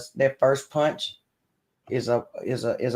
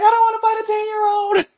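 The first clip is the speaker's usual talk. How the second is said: put-on high, loud little girl voice; high child voice refusing to fight; daughter's squeaky voice; 5 dB louder than usual. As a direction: squeak it like a scared kid. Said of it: "She was like".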